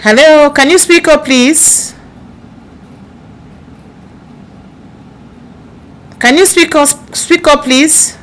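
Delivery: loud, high asking to speak up; to someone hard of hearing. Real, not acted. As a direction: project so they hear you.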